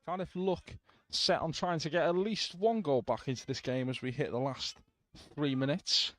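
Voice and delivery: live football commentary; play-by-play commentary. Real, not acted. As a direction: call the match as it happens.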